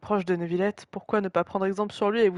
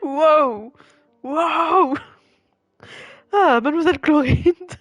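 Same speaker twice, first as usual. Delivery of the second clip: loud amazed exclamation; awed wow; 8 semitones higher, 9 dB louder, much slower than usual. Real, not acted. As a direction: gasp it out in awe. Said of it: "je pleure de surprise".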